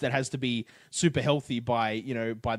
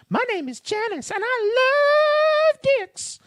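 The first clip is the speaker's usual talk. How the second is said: very high, slow airhead voice; squeaky ditzy airhead girl; 6 dB louder, much slower than usual. Retold or imitated. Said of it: "Where did that voice come from?".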